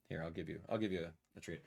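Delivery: low, hushed offer; soft pet talk. Real, not acted. Streamer offering his dog a treat, soft aside. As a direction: murmur to the dog off to the side.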